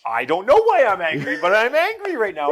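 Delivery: put-on high, loud kid voice insisting; cross daughter. Daughter telling dad she's just angry, high loud kid voice. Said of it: "And it's".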